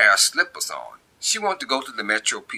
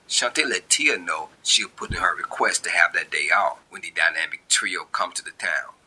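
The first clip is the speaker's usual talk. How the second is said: usual speaking voice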